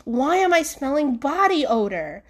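ordinary delivery

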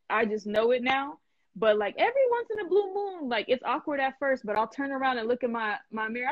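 normal talking voice

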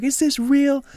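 high-pitched, overwhelmed mock disbelief; imitated joyful disbelief. Retelling the brother bent over laughing, imitated joyful disbelief. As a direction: imitate someone overwhelmed with joy.

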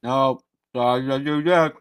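talking through a mouthful; words round a bite of food; mouth full. Real, not acted. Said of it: "speaking with your mouth full is kinda rude".

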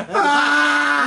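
sudden high shout while laughing; laughing cry. Real, not acted. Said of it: "laughing outburst".

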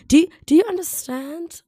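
high, loud, insistent question; hyped up. Real, not acted. Podcaster telling listeners she's ready to get going, hyped.

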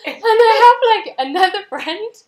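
laughing. Real, not acted. Laughing through the story.

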